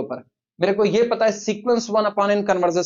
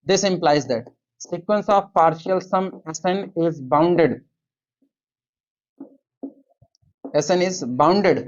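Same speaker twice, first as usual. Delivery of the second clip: very slow, word-by-word dictation; much slower than usual. Acted. Teacher dictates the inequality for students to copy, very slowly. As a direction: spell it out slowly so the class can write.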